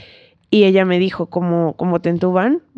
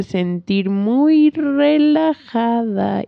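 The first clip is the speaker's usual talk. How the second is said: higher, very slow and stretched; drawn-out soothing promise; 4 semitones higher, much slower than usual. Retold or imitated. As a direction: imitate a doctor lulling a patient. Acts the anesthesiologist's soothing promise.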